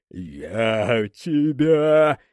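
very slow, dragged-out snarl; demon snarl. A narrator's reading. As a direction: drag it out like a beast. Demon lunges, threatening.

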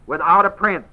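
"Without" leaned on, falling at the end; "Without" stressed, the rest light. Shown as a demonstration. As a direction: lean on "Without" then let it drop. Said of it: "That's how it's said".

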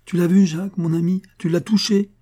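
high, loud, eyes shining with greed; excited exclamation. A narrator's reading. Old engraver hearing of the treasure, high loud excited exclaim. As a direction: burst out, thrilled at the news. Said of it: "s'exclama le ciseleur dont les yeux étincelaient".

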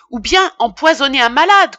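loud, high accusation; indignant tirade. A narrator's reading. Apothecary carries on berating his apprentice, loudly indignant. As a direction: press on with the outraged scolding.

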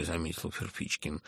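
plain reading voice

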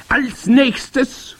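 high, loud commanding witch; grand witch. A narrator's reading. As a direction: announce it imperiously in a high witch voice. Grand witch addressing the congress.

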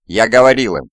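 accusing outburst. A narrator's reading. Shaken survivor blaming someone as for a grave crime, accusing. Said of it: "прошептал он".

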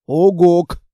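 short loud rising hoot; owl-like hoot. Acted. Monster hoots a loud reply to the girl. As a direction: hoot like a big friendly monster.

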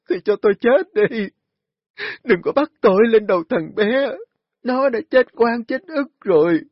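high, loud anguished wailing; wailing lament. A narrator's reading. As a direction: cry out to heaven in despair. Bà Hoạt kêu gào than khóc. (gào khóc than trời)

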